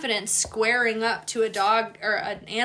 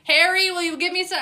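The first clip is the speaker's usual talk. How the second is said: higher, louder mock plea; TikTok trend voice; 8 semitones higher, 5 dB louder than usual. Quoting the TikTok trend, higher and louder mock begging. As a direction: pitch it up like the viral clip. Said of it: "that TikTok trend right now".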